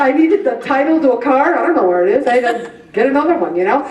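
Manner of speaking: ordinary talk, nothing marked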